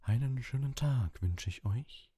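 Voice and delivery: high, slow, theatrical seer; smoky mystic voice. A narrator's reading. Professor Trelawney greets her class, smoky mystic voice, high and slow. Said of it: "rauchige Stimme".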